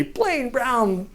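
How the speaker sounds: ordinary talk, nothing marked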